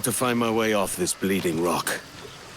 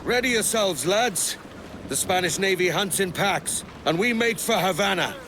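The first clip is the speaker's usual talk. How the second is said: higher, carrying call across the deck; calling to the crew; 9 semitones higher than usual. Acted. Captain rallies his crew before sailing, calling out.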